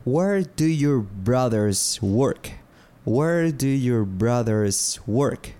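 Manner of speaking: slow, careful "Where do you live?"; slow teaching model. Shown as a demonstration. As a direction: say "Where do you live?" slowly for learners to copy. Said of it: "repeated model question".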